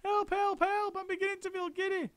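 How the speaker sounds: put-on high, frantic, fading old woman; panicked Missus Twit. A narrator's reading. Missus Twit panicking to husband about the ceiling. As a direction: babble in rising panic.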